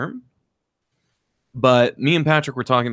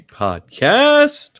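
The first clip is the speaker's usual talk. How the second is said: hype intro; 14 semitones higher, 6 dB louder than usual. Acted.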